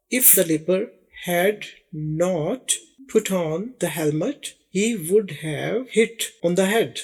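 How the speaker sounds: slow example read. Shown as a demonstration. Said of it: "اب اس کی English کیسے بنتی".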